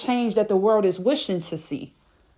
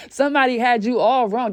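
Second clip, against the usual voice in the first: usual speaking voice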